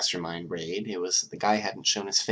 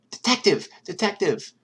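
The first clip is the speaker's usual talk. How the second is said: much higher, loud calling out; urgent calling; 13 semitones higher, 6 dB louder than usual. Acted. Nezu calls the detective over the phone, loud and urgent. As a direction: shout to get his attention.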